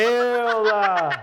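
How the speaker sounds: incredulous refusal. Retold or imitated. Refusing friend's request, retold.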